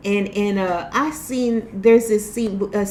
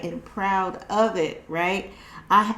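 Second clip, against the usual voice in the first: ordinary delivery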